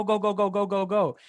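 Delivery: ordinary talk, nothing marked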